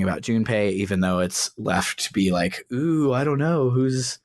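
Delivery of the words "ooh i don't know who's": feigned wondering. Acted. Obvious she's talking about Junpei, feigned wondering. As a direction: play at not knowing.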